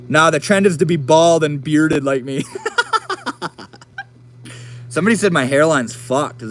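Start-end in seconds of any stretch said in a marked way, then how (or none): 2.5-3.6 high burst of laughter. Real, not acted. Laughing at own bald joke.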